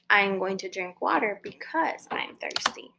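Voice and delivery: hushed unvoiced whisper; soft whispered translation. Shown as a demonstration.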